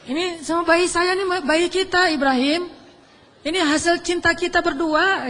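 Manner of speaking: slow, high, hammed-up pleading; soap-opera wife. Wife melodramatically begging Ibrahim to stay, hammed-up. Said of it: "Sinetron banget ya".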